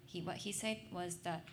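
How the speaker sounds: ordinary delivery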